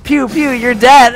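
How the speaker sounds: loud, slow pew pew taunt; play-shoot your brother; gun noises. Retold or imitated. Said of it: "I was like".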